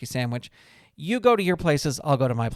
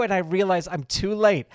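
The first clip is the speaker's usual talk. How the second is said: normal talking voice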